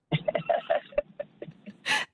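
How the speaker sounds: high, unvoiced burst of laughter; breathy laugh. Real, not acted. Host cracking up at her own family story, breathy laugh. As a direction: let a breathless laugh escape.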